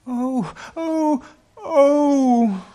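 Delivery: high wails building to a loud cry; girlish wailing, very high; anguished cry. A narrator's reading. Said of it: "cried the two girls".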